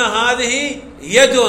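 "هذه يد" said very slowly, each word drawn out; slow example. Shown as a demonstration. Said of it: "في قولنا". (نطق "هذه يد" ببطء شديد)

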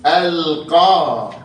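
"القاضية" بياء مفتوحة ممدودة، ببطء; مدّ "القاضية" ببطء; مثال منطوق. Shown as a demonstration. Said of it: "وبعد رأيت". (نطق الياء المفتوحة ببطء)

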